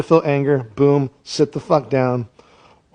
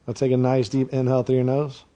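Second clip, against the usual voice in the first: guided meditation; 4 semitones lower, 4 dB quieter than usual. Acted. Comic guru guiding angry workers, soothing. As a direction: ease them down body part by body part. Leads a breathing exercise.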